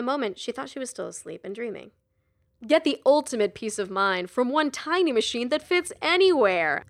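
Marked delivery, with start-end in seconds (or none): 2.7-6.8 loud upbeat infomercial announcer; jaunty announcer. A narrator's reading. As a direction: sell it with a grin. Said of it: "a jaunty voice told her".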